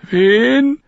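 put-on a startled boy asking back; captured boy puzzled by the name. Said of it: "fragte Lommi verdutzt".

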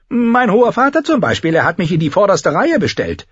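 high, insistent character voice; high voice, pressing the point; Tyrion, wry lordling. Acted. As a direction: play the little lord, pitched high and forceful. Tyrion's dialogue in narration.

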